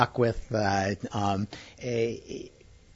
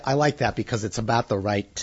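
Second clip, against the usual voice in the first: ordinary talk, nothing marked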